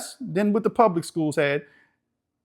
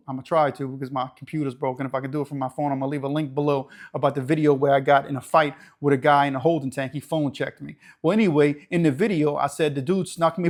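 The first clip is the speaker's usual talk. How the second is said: usual speaking voice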